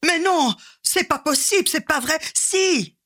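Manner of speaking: rising panic, nearly shouting Si; high frantic Mais non, c'est pas possible; panicked disbelief. Acted. Third escalating disbelief.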